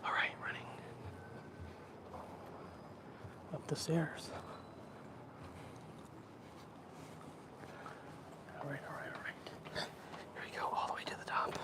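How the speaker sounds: quiet whisper while climbing. Real, not acted. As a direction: keep it hushed in public. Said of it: "I'll talk normal now".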